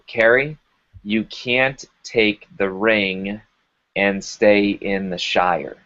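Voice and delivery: slow, deliberate coach's words, each word placed; slow weighty quote. Retold or imitated. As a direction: let the line land slowly and heavily. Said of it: "He says".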